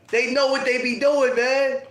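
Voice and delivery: normal talking voice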